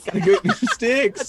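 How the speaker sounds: high, loud, drawn-out through laughter; laughing mock fuss. Player teasing about new sticks, laughing mock fuss. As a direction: wail it slowly while laughing.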